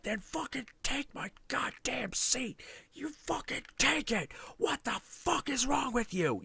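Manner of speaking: retold rant squeezed out in a hoarse strained whisper; half-whispered mock tirade; strained whisper-yell. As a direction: hiss the fury without voicing it. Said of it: "I am going off".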